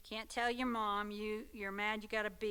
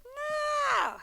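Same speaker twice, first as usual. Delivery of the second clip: much higher and louder; mock exclaimed no; 9 dB louder than usual. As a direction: toss out a big squealed no. Kid scoffing at the idea.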